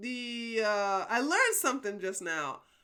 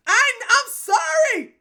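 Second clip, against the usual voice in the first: loud apology; 12 semitones higher, 13 dB louder, slower than usual. Real, not acted. Apologizing to chat.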